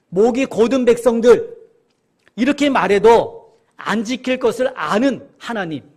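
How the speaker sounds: loud, slow, weighty proclamation; emphatic preaching. Real, not acted. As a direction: drive it home to the congregation, each phrase deliberate. Preacher driving home God's foreknowledge. (크고 느리게 힘주어 선포)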